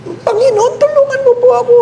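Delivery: high, fast panicked plea to God; a man clinging to a branch, begging to be saved; hammed-up frantic prayer with a laugh; desperate cry for help.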